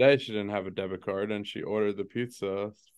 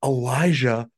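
put-on child's indignation; 4 semitones higher, 6 dB louder, slower than usual. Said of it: "He's like".

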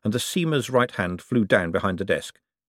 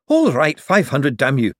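louder, higher, bitter concession; angry giving in; 5 semitones higher, 5 dB louder than usual. Acted. Young man conceding to the forger, angry. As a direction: spit it out, defeated.

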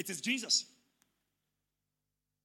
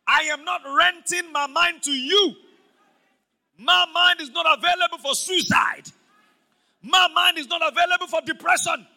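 loud, slow, higher-pitched confession; shouted declaration; 5 semitones higher, 12 dB louder, slower than usual. Shown as a demonstration. Pastor leading the congregation in a loud confession. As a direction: declare it like a battle cry. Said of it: "Say with me".

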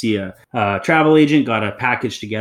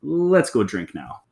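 mocking past self; 9 semitones higher than usual. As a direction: play your old self brushing it off, lightly. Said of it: "I'd be like".

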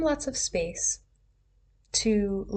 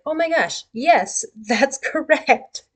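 high, louder, laughing oh my gosh; delighted agreement; 5 semitones higher, 5 dB louder, slower than usual. Retold or imitated. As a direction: burst out in happy recognition. Sudden delighted realisation.

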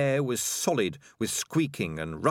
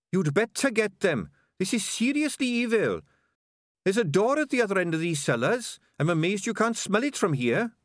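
high-pitched excitable rat reporting trouble; Sardines the rat; 10 semitones higher than usual. A narrator's reading. Rat reporting trouble to his boss, high-pitched and urgent.

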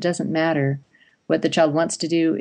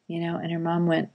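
ordinary delivery

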